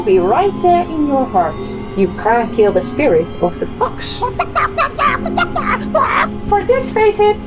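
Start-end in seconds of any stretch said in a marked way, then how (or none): 4.2-6.4 bright, high laugh. Acted. Fox laughs.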